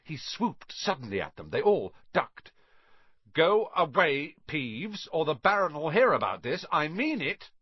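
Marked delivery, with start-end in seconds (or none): 3.3-7.5 louder stern bark. A narrator's reading. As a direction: snap the order as a prefect. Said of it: "barked Percy".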